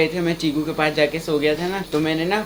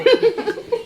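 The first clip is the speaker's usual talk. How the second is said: a sudden shrieking laugh; high burst of laughter. Real, not acted. Brother cracking up at the sister's story. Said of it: "So funny दीदी".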